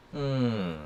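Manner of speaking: normal talking voice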